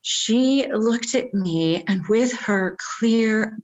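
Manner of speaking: usual speaking voice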